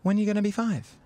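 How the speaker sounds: put-on sing-song to a toddler; high mock kid talk. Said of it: "And I go".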